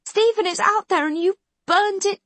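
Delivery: highest, loudest screamed accusation; "STEPHEN is out there", hit "Stephen" hardest. A narrator's reading. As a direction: Khan screaming that he burned the town. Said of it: "she's still screaming at him".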